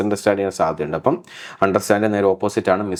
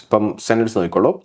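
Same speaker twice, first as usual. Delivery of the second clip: ordinary delivery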